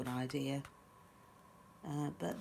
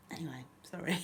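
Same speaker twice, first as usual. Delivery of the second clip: ordinary delivery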